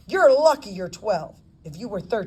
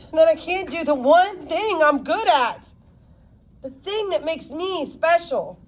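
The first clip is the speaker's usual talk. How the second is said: barely masked depression; 8 semitones higher than usual. Acted. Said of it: "barely masking her depression".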